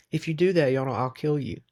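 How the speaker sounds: a hint of icy menace, read lightly; lightly voiced cold threat, each word edged; cold and cutting. A narrator's reading. Woman threatening the man who would betray the boy, coldly. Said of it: "her voice was as cold and cutting as a knife".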